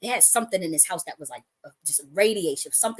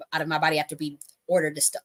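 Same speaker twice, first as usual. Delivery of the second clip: usual speaking voice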